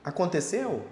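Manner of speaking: a light told-you-so quip, rising on não; mild gloating at someone who was warned; smug told-you-so. Acted. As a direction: tease the other person lightly with te avisei. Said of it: "Então você vira e fala".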